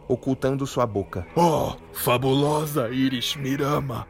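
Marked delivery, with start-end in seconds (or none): 1.3-4.0 lightly voiced begging hero; humble pleading. Troy pleading.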